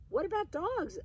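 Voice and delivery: put-on eager boy. A narrator's reading. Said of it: "asked Egan".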